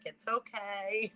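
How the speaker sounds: slow, soft it's okay; gently forgive the child; slow soothing reassurance. Retold or imitated. Father forgiving the child's confession, slow and soft. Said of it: "it's like".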